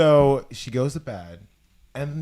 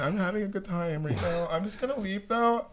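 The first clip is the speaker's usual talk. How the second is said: fake cheerful; 6 semitones higher, 5 dB quieter than usual.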